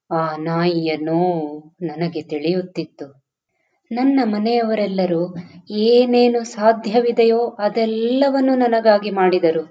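plain reading voice

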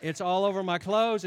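ordinary delivery

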